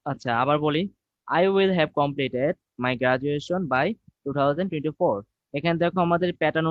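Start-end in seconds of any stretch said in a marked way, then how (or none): 1.3-5.1 slow dictation. Shown as a demonstration. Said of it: "teacher rereads example sentence".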